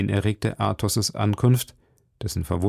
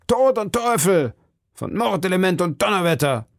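hoch und laut gerufene Flüche; lautes Fluchen; 9 semitones higher than usual. Acted. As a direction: schimpf wie ein wütender Musketier. Flüche erschallen im Raum. (laut fluchend)

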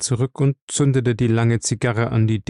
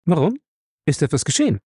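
higher, pointed, suspicious question; sharp counter-question; 6 semitones higher, faster than usual. A narrator's reading. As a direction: shoot the question back sharply. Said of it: "kam eine scharfe Gegenfrage".

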